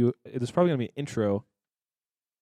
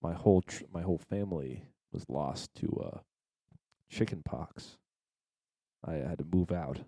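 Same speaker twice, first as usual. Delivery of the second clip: mock tragic; 5 semitones lower, 8 dB quieter than usual. Mock sad backstory, slow low.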